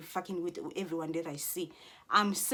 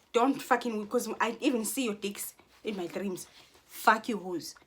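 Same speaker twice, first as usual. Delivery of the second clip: ordinary talk, nothing marked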